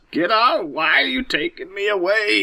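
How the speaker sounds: high, drawn-out mock outcry; imitated agitated protest. Grandpa fighting relatives at the car, imitated high protest.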